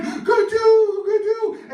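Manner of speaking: high, loud screamed call, drawn out; Saru screaming. Retold or imitated. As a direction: imitate a child screaming after his brother. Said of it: "he's screaming".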